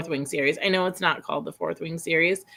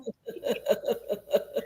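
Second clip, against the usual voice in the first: breathy laugh; 7 dB quieter than usual. Real, not acted. Laughing at nephew story.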